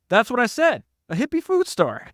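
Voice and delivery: high-pitched, exasperated comeback; exasperated insistence. Retold or imitated. Acted argument over the store.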